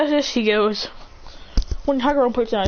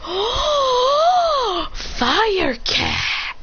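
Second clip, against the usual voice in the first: much higher, squealing with delight; delighted gasp; 13 semitones higher, much slower than usual. Acted. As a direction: shriek it like a kid spotting a treasure.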